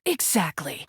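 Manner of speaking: ordinary delivery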